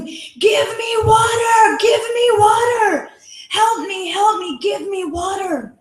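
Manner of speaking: high crying shout for water, fading at the end; retold desperate scream. Man with head on fire screaming for water, high desperate cry. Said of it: "you will scream".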